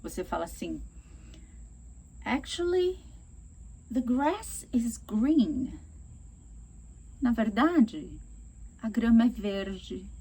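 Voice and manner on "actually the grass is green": slow, gentle correction; just give the right information, no attack; calm and polite. Shown as a demonstration. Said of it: "Você não tá sendo agressivo".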